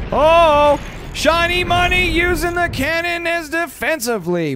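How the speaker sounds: high, amazed commentator shout; excited play-by-play. Real, not acted. Caster amazed by a cannon used defensively, excited. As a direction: burst out loud on the Oh, then keep it high.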